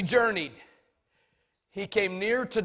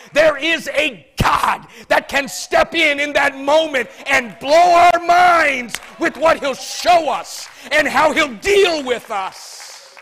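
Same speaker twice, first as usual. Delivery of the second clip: preaching; 5 semitones higher, 12 dB louder than usual. Acted. Preacher at full force.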